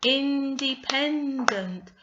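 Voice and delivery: INDEPENDENT slow, high and loud, in four beats; syllable by syllable. Shown as a demonstration. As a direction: sound out IN-DE-PEN-DENT. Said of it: "so that's four syllables".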